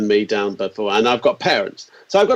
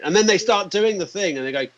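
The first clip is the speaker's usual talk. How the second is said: usual speaking voice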